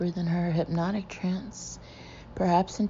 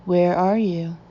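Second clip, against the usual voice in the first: louder, demanding question; hard stern voice; 7 dB louder than usual. A narrator's reading. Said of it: "switching from a soft to a hard voice".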